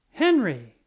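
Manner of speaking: loud sharp yell of a name. A narrator's reading. Father yelling for Henry in the puddle, loud. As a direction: call the boy in alarm. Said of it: "and he yelled".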